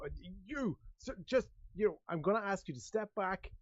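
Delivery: high, faltering nervous request; freaked-out guard. Acted. Nervous guard asking Claude to back off, flustered. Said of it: "this is freaking the guard out".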